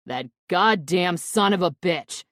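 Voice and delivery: loud furious outburst; angry shout. A narrator's reading. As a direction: explode at the betrayal. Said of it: "Quinn shouts angrily".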